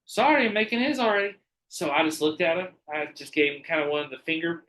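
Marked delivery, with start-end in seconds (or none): 0.0-1.4 imitating the clerk. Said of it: "she's like".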